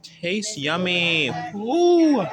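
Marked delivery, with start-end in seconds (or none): 1.5-2.2 delighted ooh. Acted. Delighted at tasting cake.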